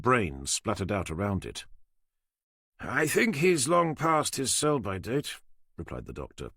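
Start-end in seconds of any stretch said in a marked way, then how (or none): 2.8-5.4 higher, slow, dry sarcastic quip; sanguine sarcasm. Acted. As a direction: savour the joke slowly, unbothered. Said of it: "with his normal sanguine sarcasm".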